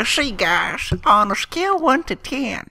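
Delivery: croaky put-on old lady voice. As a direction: play a cranky old granny.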